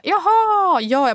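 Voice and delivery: high, loud "oh, I see"; exaggerated dawning of the penny dropping; acted-out realization. Acting out sudden realization at marathon.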